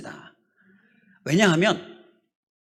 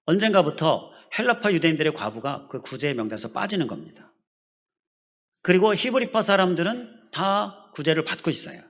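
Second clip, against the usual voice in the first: ordinary talk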